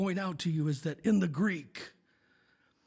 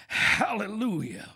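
ordinary talk, nothing marked

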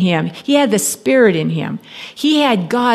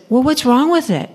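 higher, light voice of a sales clerk; clerk's question; 5 semitones higher than usual. Retold or imitated. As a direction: play the cheerful phone-shop girl. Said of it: "this girl says".

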